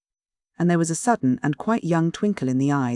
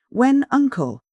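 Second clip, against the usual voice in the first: high, sharp When, uncle; startled question; 9 semitones higher, 4 dB louder, slower than usual. Acted. Niece startled by uncle's abrupt question, high and sharp. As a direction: caught off guard, blurts it out. Gwenna asks defensively.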